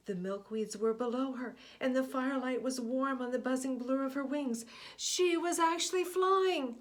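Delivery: rising to high, loud joy; joyful climax as she flies; triumphant. A narrator's reading. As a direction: let the wonder burst out.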